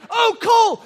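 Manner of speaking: loud, high-pitched alarm; alarmed cry. Retold or imitated. Dad remembering his son beside him, alarmed. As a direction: cry out in worry for your child.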